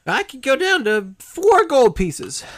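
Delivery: high, loud, slow hag voice; drawn-out, high-pitched hag bargaining. Acted. Hag lowers her price.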